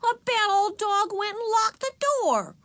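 put-on high, wailing child's complaint; angry shout. A narrator's reading. Ramona blaming the dog to Mother, high angry shout. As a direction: protest crossly in a very high child voice. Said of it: "shouted Ramona angrily".

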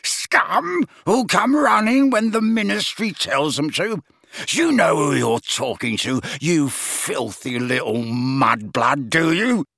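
high screech sinking to slow venom; screaming rage. A narrator's reading. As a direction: rant furiously, drawing out the insults. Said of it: "screamed Gaunt".

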